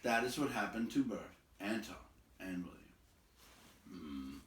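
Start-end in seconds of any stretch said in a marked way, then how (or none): 3.9-4.4 a pleased low hum; wizard voice. A narrator's reading. Gandalf pleased at the trolls' end, low. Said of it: "said Gandalf".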